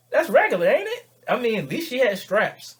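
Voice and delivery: loud, high comic protest; indignant defence. Acted. Man defending a girl's shirt to his accuser, indignant.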